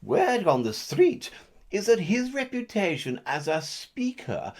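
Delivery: punchy newsreader delivery; mock news read. Anchor reporting the mayor's approval ratings, in a mock newsreader voice.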